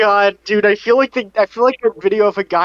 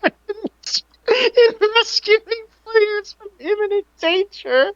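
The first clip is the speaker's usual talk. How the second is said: laughing; much slower than usual. Real, not acted. Breaks up mid-impression.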